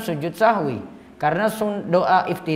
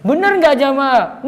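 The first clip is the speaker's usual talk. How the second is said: higher, louder, slow call to the congregation; mock preacher asking the crowd; 7 semitones higher, 6 dB louder than usual. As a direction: draw the crowd out like a showy preacher. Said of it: "Nggak bisa kalau khutbah Jumat". (meniru khatib bertanya kepada jamaah)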